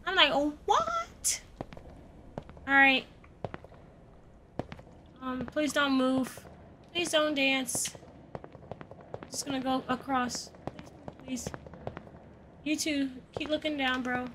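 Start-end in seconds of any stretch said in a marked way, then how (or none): 0.3-1.4 soft, high-pitched squeak of disbelief; mock shock. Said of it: "He is as shocked as me". 5.2-14.3 very soft, careful begging; hushed pleading. Real, not acted. Creeping past figures, pleading.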